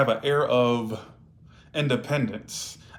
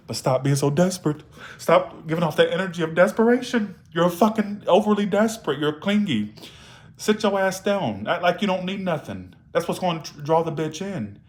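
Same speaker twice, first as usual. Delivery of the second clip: raised-pitch, emphatic tough-love telling-off; blunt scolding; 5 semitones higher than usual. Real, not acted. Tarot reader telling clingy viewers off, blunt scolding. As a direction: lay down the law to a clingy client.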